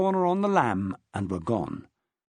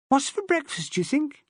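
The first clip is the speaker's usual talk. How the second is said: put-on little boy; 11 semitones higher than usual. Small boy asks dad.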